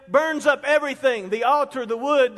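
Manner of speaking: ordinary talk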